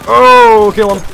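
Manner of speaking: high, very loud yell; urgent shout. Real, not acted. Player to team on spotting an enemy, urgent shout. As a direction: yell it in sudden alarm.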